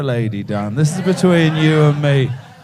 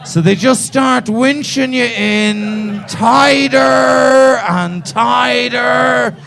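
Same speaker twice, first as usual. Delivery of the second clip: hammed-up slow build on tighter; slow building emphasis; 7 semitones higher, 3 dB louder, slower than usual. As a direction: stretch each tighter slower and higher. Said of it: "drawn-out repetition".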